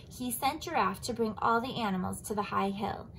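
ordinary delivery, nothing marked

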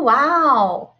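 lively storybook read-aloud; sing-song picture book voice; reading to children. Acted. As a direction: read it bright and animated for the kids.

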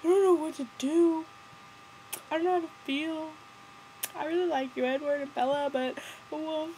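very high, mopey impression; hammed-up sad boy voice; mock forlorn. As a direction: whine it like a helpless sad sack. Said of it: "forlorn expression, mm, sad face".

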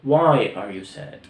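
Why are you sad said very slowly and clearly; teacher spelling out the question; slow classroom drill; slow model question. Shown as a demonstration.

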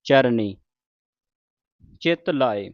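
ਚਰਨੀ with a curled-tongue ਣ; slow, clear ਣ in ਚਰਨੀ; ਚਰਨੀ ਵਿੱਚ ਣ; retroflex n. Shown as a demonstration. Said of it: "ਜਿੱਥੇ ਨਾਣਾ ਉੱਥੇ ਨਾਣਾ ਹੀ ਬੋਲੋ".

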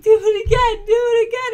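high, very loud mock-toddler squeal; excited, hammed-up kid shout; gleeful child. Laughing child begs for repeat.

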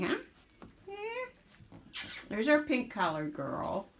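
usual speaking voice

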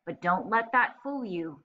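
ordinary delivery, nothing marked

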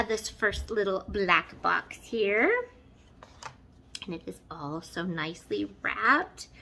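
ordinary delivery

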